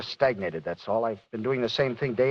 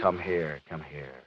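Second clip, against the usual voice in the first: tender; 7 semitones lower, 6 dB quieter, slower than usual. Acted. Husband comforting his wife.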